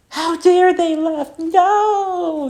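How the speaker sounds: high, loud, drawn-out mock wail; hammed-up indignant cry; mock outrage. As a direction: overdo the outrage, slow and loud. Mock outrage, then says just kidding.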